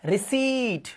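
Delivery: रिसीट with a long drawn "ee" and no P; long ee, silent P. Shown as a demonstration. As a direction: stretch the ee in रिसीट, "ri-SEET". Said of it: "बड़ी की मात्रा".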